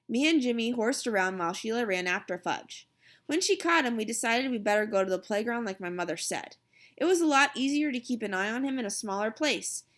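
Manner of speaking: ordinary delivery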